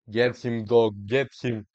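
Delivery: loud hyped cheer; hyped yelling at the screen. Real, not acted. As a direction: urge him on at full voice. Cheering players on loudly.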